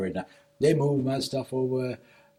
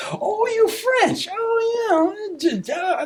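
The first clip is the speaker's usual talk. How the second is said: much higher, loud, slow mock exclamation; imitating surprise; 6 dB louder, much slower than usual. Landlady reacting to his French, mock surprised exclaim. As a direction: ham up her startled delight.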